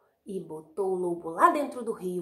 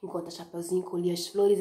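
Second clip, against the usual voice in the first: ordinary delivery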